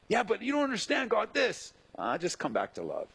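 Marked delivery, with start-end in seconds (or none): 0.1-1.7 whiny protest. Retold or imitated. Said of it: "Ed protesting to God".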